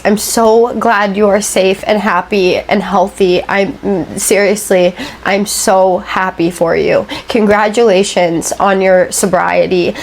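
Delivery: usual speaking voice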